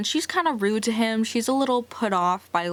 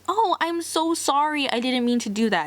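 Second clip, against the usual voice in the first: higher, bright mock apology; apologetic handsome man; 6 semitones higher than usual. Handsome man after hitting her with snow, gushing sorry. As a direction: gush an apology like a charming stranger. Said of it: "handsome man that's like".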